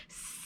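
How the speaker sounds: long, soft hiss; drawn-out pretend snake sound. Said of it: "snakes all hissing".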